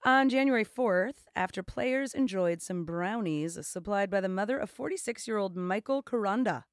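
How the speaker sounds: projected newsreader delivery; announcer-like, emphatic story read; news read. Real, not acted. Reads a news item.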